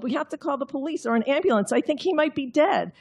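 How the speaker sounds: high, fast, frightened rush; breathless alarm to a stranger; panicked plea. A narrator's reading. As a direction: blurt it out in a panic. Panicked plea after finding body.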